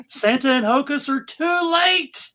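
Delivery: normal talking voice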